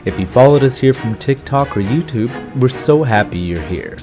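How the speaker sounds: upbeat podcast announcer read; announcer intro. Acted. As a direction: welcome listeners like a host.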